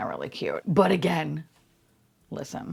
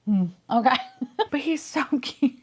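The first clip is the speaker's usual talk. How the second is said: normal talking voice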